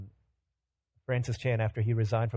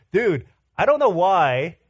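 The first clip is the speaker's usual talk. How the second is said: exasperated complaint; 5 semitones higher, 8 dB louder, slower than usual. Retold or imitated. Complaining to team about zipper.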